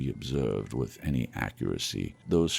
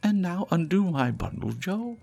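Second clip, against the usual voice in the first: much higher, quick charwoman voice; eager charwoman; 3 dB louder than usual. Acted. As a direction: rattle it off greedily, high-pitched. Charwoman demands Joe open bundle.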